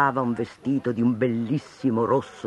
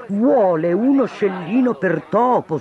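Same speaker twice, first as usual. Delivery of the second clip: ordinary delivery, nothing marked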